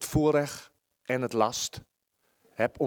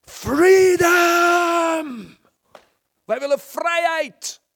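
much higher, much louder battle shout; battle cry shout; 10 dB louder, slower than usual. Retold or imitated. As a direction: roar it like a Scottish army. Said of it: "En wat schreeuwen ze?".

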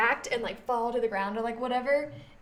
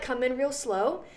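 normal talking voice

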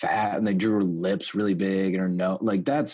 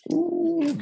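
much higher, strained Smeagol squeal. Pretend. Friend asks for his Smeagol, high squeal. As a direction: squeeze it up high like Gollum. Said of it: "Do your Smeagol".

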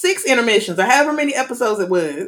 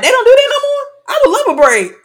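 high, loud disbelieving squeal; incredulous; 5 dB louder than usual. Retold or imitated. Retold reaction to intermission.

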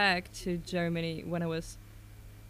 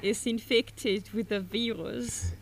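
put-on Indian accent; 2 semitones higher, 4 dB louder, slower than usual. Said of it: "do an Indian tech support voice".

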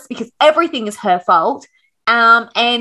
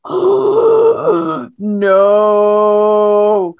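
mock terror; 4 dB louder than usual. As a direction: gasp it out like a horror scene. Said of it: "I was terrified".

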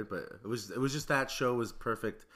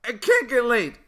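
high, loud silly imitation; mocking high voice; 11 semitones higher, 11 dB louder, slower than usual. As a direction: ham up a squeaky impression. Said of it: "Why do I sound like a fucking llama".

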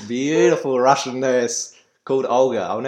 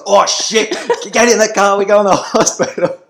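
high, loud panicked shout; dad suddenly panicking, ordering son into the car; 10 semitones higher, 11 dB louder than usual. Retold or imitated.

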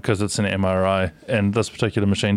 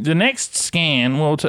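slow, lightly raised announcer voice; American lady voice; 5 semitones higher, slower than usual. Retold or imitated. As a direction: mimic the scanner announcement. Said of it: "just every single time".

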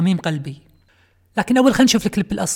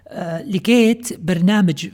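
ordinary delivery

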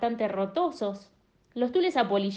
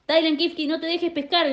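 grito desde abajo; 7 semitones higher than usual. Acted. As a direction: call out to the elephant up in the sky. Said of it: "gritaba uno". (grito hacia arriba)

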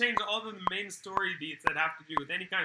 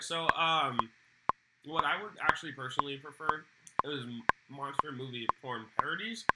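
ordinary delivery

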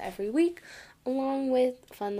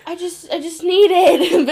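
higher, louder, giggling through a dumb excuse; laughing through a quote; 9 semitones higher, 9 dB louder than usual. Retold or imitated. Mocking Tom's excuse to his nan, laughing through it. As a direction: try to say his lame excuse but crack up. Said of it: "he's like".

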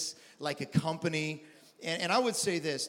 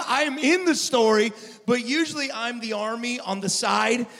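preaching at full force; 5 semitones higher, 8 dB louder than usual. Acted.

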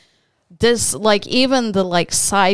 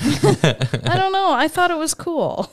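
laughing; 10 semitones higher than usual. Real, not acted.

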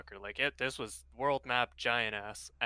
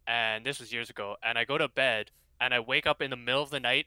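ordinary talk

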